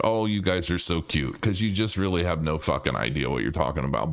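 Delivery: put-on low, patronizing coo to a child; condescending. To people buying narratives, low condescending mock-sweetness. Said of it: "I'm just like".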